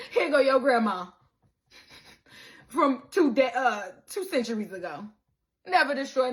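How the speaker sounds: loud, laughing, teasing voice of God; loud mock divine showman; booming God voice. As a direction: play God showing off, loud and playful. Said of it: "God's like".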